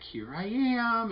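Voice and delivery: high, slow sing-song tease from the lump; creepy taunting tumor. Pretend. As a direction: drag it out high like a lurking growth. Tumor on x-ray voiced.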